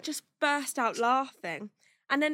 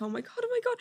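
retold helpless distress; 10 semitones higher, much faster than usual. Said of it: "I'm, like, crying, laughing".

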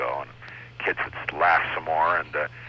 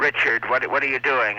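a lisping school principal, a little higher; imitating principal with lisp; 7 semitones higher, 3 dB louder than usual. Principal calls out to young Richard, in a lisping imitation. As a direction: mimic the old principal's lisp, lightly. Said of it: "He talked like this".

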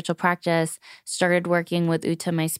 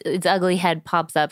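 normal talking voice

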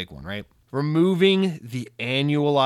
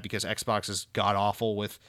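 ordinary talk, nothing marked

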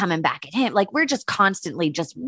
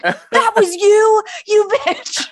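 high, loud, slow squeal of mock outrage; friend's mock accusation; 14 semitones higher, 6 dB louder, much slower than usual. Friend realizing who sent the gift, high loud mock accusation. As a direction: ham up the friend catching on.

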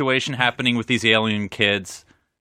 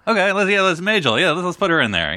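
excited producer; 7 semitones higher than usual. Pretend. Mock producers casting the show.

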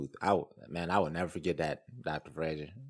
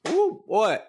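excited whoop; 9 dB louder than usual. Real, not acted. Cohost reacts to the doctor's list with a high loud whoop. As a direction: whoop it up like a hype man.

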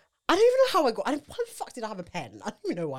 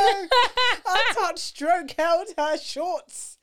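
higher, louder giggling confession; giggly; 8 semitones higher, 8 dB louder, much slower than usual. Real, not acted. Confessing to her co-host that she held [name]'s shorts, giggly. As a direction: laugh it out, embarrassed.

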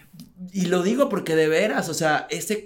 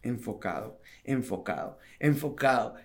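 inner mantra; 6 semitones lower, 8 dB quieter than usual. Retold or imitated. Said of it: "decía en mi mente".

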